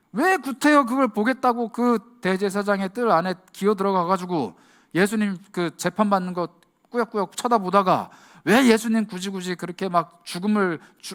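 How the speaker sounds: ordinary delivery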